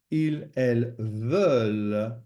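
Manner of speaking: "ils, elles veulent" slow, stressing final "veulent"; emphasis on the ending. Shown as a demonstration. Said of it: "Notice the emphasis there on the ending".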